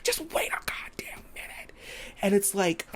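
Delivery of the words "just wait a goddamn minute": high, voiceless angry whisper; wife hisses at her leaving husband; furious whisper. Retold or imitated. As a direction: stop him at the door with a hushed fury. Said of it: "and she's like".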